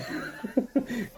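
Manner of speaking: short high giggle; a quick awkward laugh; embarrassed laugh. Real, not acted. Embarrassed about name [name].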